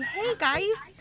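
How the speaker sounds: high, loud Midwestern twang; Midwestern accent. Acted. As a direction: chipper Midwestern fox farmer. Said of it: "a Midwestern accent".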